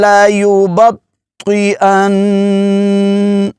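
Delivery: slow, high, melodic tilawat; Quran recitation. Shown as a demonstration. Teacher reciting the verse for students, slow melodic chant. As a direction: chant it slowly in a drawn-out melody. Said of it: "پھر سن لیجئے".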